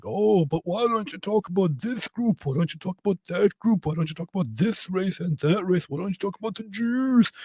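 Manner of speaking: high, whiny mock voice mimicking online critics; nasal sarcastic impression of a critic; mocking whiny commenter. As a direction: sneer it like a petty troll in the comments. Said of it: "who say dumb stuff like".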